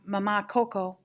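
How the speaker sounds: plain reading voice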